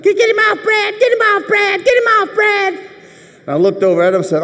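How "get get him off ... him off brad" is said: high-pitched panicked yelling; frantic shout. Retold or imitated. Trainer yelling at the quarterback to pull Rocky off, panicked. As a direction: scream for help, way up high. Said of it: "And John is jumping up".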